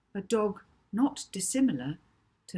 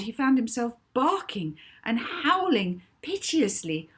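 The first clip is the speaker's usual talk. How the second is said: frantic narration; 6 semitones higher, 6 dB louder than usual. A narrator's reading. Narrating a barking fit.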